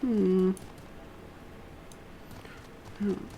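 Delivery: ordinary talk, nothing marked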